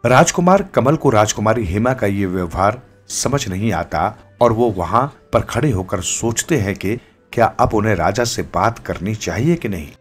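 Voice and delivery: ordinary delivery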